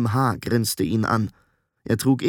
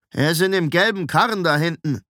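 higher, irritated character voice; grudging, unfriendly reply; hostile answer; 6 semitones higher than usual. A narrator's reading. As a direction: answer him unwillingly.

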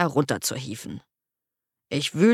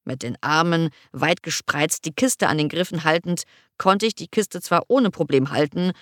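ordinary delivery, nothing marked

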